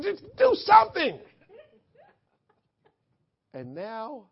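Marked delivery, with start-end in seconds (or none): 0.0-1.2 loud, high mock-exasperated yell; exasperated shout. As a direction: shout it like a fed-up passenger. Loud complaint on airplane retold.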